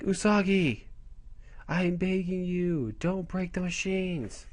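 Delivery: lightly voiced desperate shopkeeper; pleading arcade owner. Arcade owner pleads with Usagi, slow mock begging. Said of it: "arcade owner's like".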